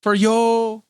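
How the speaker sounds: mock Mexican waiter warning; Speedy Gonzalez-style accent. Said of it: "a Speedy Gonzalez impression".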